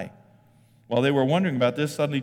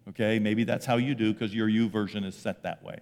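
ordinary talk

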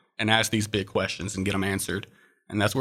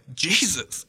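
calling out Jesus, raised in pitch, not a real yell; retold scream; 6 semitones higher than usual. Falling in the nightmare, he screams for Jesus, mildly re-enacted. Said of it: "and I screamed it".

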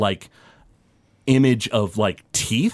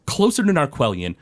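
ordinary talk

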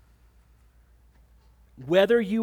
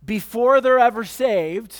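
much louder and higher, driving the point home; emphatic preaching; 5 semitones higher, 9 dB louder than usual. Real, not acted. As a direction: hit this line hard from the pulpit. Preacher presses key point.

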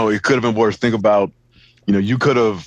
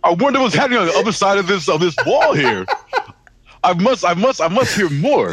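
higher, playful mock curiosity; mock nosy guy; 8 semitones higher than usual. Friend voicing the nosy stranger, playfully. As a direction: play a snoopy stranger, half laughing.

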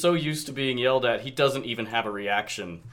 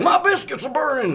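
high, loud cartoon cowboy yelp; hammed-up cartoon holler; Yosemite Sam; 14 semitones higher, 6 dB louder than usual. Shown as a demonstration. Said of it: "try and do Yosemite Sam".

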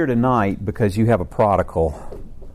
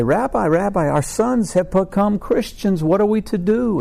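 much higher, slow pleading voice; high comic pleading; 10 semitones higher than usual. Acted. The fathers plead with the rabbi, high comic voice. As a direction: ham up two worried fathers begging.

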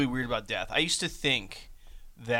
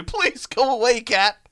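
pleading; 7 semitones higher, 5 dB louder, much slower than usual. Retold or imitated. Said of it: "this guy was trying to".

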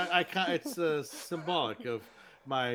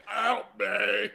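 louder, higher mock plea; mock cry for help; 8 semitones higher, 5 dB louder than usual. A mutant shopper begs the vendors, higher and louder.

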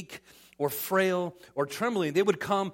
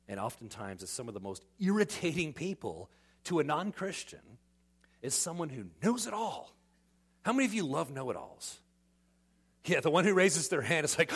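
ordinary talk, nothing marked

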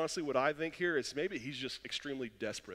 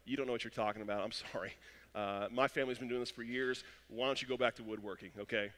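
muttered dismissal; 5 semitones lower, 5 dB quieter than usual. Retold or imitated. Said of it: "Peter has every right right here to go".

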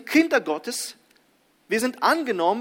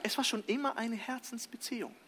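soft emphasis; 4 semitones higher, 9 dB quieter than usual. Shown as a demonstration. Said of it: "will Paulus sagen".

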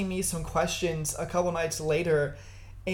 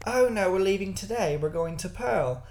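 mock posh British accent. British friend answering the lady about leaving, posh British accent. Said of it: "our British friend goes".

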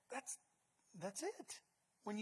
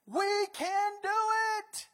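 high, slow, ringing cry; triumphant proclamation; 10 semitones higher, 8 dB louder, much slower than usual. Acted. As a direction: proclaim it to the whole assembly. Caleb and Joshua proclaiming.

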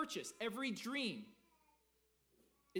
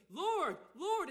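"Lord, Lord" much higher and louder, fervent; emphatic excited; 9 semitones higher, 7 dB louder than usual. Shown as a demonstration. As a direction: call "Lord, Lord" out with excited urgency. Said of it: "it's an emphatic, uh, excited".

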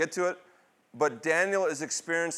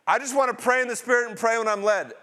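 higher, quick parody of a pious excuse; mock objection; 6 semitones higher, 4 dB louder, faster than usual. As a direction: rattle it off in a high voice as someone else's excuse. Voicing a common pushback, mockingly.

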